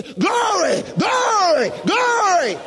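high, loud bursts of praise; shouting glory. Retold or imitated. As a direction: burst out shouting, led by the Spirit. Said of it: "Why are they shouting glory?".

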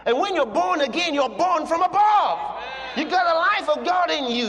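full-voiced preaching climax; preaching shout. Real, not acted. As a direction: shout it out to the congregation.

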